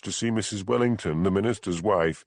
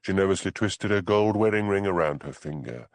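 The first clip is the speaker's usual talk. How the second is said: ordinary delivery, nothing marked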